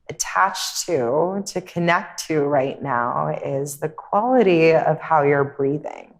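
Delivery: calm, unhurried meditation voice with long pauses; guided meditation. Real, not acted. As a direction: guide them gently inward, leaving space between phrases.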